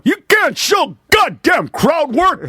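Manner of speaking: high, slow mock shout of a cranky old comic; hammed-up angry old man shouting; grumpy old comic. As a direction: bark it like a bitter old-timer yelling at kids. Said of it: "that old guy doing".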